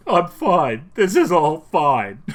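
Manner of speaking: on the verge of tears, high and loud; strained, slow cry-holding impression; Patrick Warburton. Fan imitating Kronk's actor about to cry, tearful and loud. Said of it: "but, like, he's about to cry".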